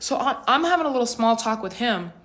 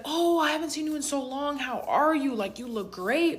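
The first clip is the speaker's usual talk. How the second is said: higher-pitched mock friendly gush; gushing greeting; 6 semitones higher than usual. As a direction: imitate an over-friendly long-lost acquaintance. Acts out stranger's gushing greeting.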